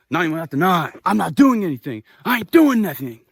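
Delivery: loud, high, aggressive denial; imitated angry yelling. Man leaning at the girlfriend, mock angry shouting. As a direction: act out the man yelling in outrage.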